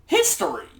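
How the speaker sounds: "History!" blurted loud and high, falling off; excited. Real, not acted. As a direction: cheer "History!" like a treat at last. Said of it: "My favorite subject".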